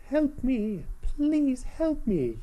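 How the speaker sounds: high, slow, pleading princess voice; Princess Zelda voice. Acted. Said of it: "that's my Princess Zelda voice".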